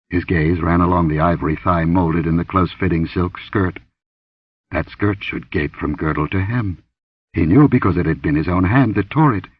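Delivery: ordinary delivery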